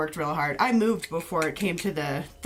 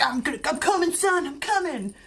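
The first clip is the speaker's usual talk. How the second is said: imitating a dad; 9 semitones higher than usual. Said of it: "So dad's like".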